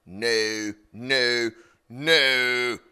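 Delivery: building no. Retold or imitated. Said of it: "I'm like".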